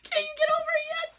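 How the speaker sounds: high, loud, fast whiny voice; hammed-up whiny mock of husband; mock exasperated husband. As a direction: whine it like a fed-up teen. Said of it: "He goes".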